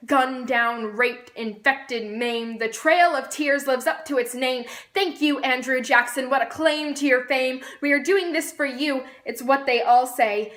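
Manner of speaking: rhythmic, declaimed slam poetry; spoken-word poem. Acted. Poet on American propaganda and fear, spoken-word recital. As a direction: recite it as a pointed political poem, building in force.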